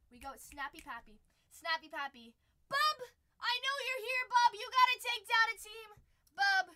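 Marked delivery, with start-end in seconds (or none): 2.7-6.7 loud, high hail to a regular; calling out. Real, not acted. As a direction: holler at a viewer to join in. Calls out to a viewer.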